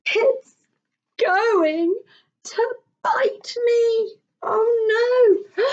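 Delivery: high frightened wail; scared child. Acted. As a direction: cry out like a frightened boy.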